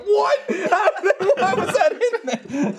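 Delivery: high-pitched, incredulous retold reaction; laughing disbelief. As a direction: react in baffled shock through laughter. Retells his shock on watching.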